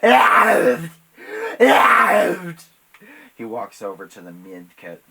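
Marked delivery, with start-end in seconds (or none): 0.0-2.6 crying, high-pitched screamed rant; unhinged, tearful shrieking at full volume; hysterical screaming. Acted. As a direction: scream it through sobs. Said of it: "Dave, hysterical, screaming".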